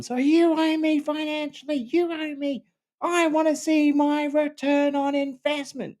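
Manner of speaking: very high, demanding mock rant; a wife yelling demands over her husband, hammed-up; imitating a screaming wife. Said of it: "It would be that kind of stuff".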